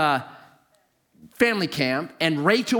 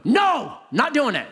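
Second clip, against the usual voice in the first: high, emphatic "no"; retold high-pitched refusal; defiant refusal; 5 semitones higher, slower than usual. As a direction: cut back in with a mock stubborn protest. Refusing the voice once more.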